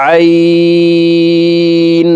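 "আইন" from mid-throat, held very long on a high level tone; four-alif stretch. Shown as a demonstration. Teacher demonstrating ain from mid-throat, long drawn-out hold. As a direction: draw out "আইন" as one steady chanted note. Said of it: "হালকের মধ্যখান থেকে উচ্চারণ করব".

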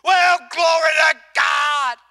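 loud, high, slow shout of praise; joyful shout. Retold or imitated. Preacher shouting his praise down the aisle. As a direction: call out glory to God like you can't hold it in. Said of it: "all of a sudden I heard".

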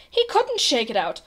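usual speaking voice

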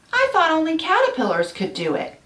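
plain reading voice

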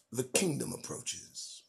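"The kingdom approaches" said low, soft and slow, falling at the end; hushed proclamation. Real, not acted. Preacher warning the congregation, hushed and slow. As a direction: murmur "The kingdom approaches" like an ominous prophecy.

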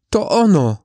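sudden high cry of recognition. A narrator's reading. Mother finding her child's flower, crying out high. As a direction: call out, voice leaping up. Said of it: "zawołała".

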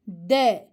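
"D." recited thin with an e vowel, low; reciting letter sound. Shown as a demonstration. Teacher correcting a common misreading, thin de. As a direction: say "D." as a short thin de. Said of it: "E sesiyle ince okunur".

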